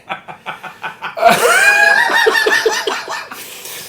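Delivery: helpless squealing laughter; high shrieking laugh. Real, not acted. Laughing fit after bit.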